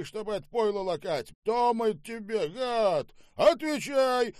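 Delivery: high-pitched, loud ranting; furious tirade. Acted. Boss abuses the manager and demands an answer, furious. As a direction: build the abuse to a yelled demand.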